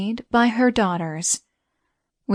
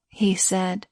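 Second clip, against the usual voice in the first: plain reading voice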